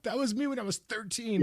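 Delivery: laughing while mimicking a young teen's squeak; squeaky kid voice. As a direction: squeak it out like a pubescent boy. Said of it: "You're like".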